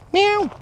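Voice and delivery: high, loud imitated meow; mock cat meow. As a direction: squeal out a sudden cat meow. Imitates a cat noise mid-walk.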